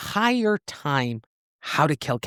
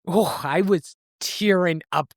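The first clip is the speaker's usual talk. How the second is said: ordinary talk, nothing marked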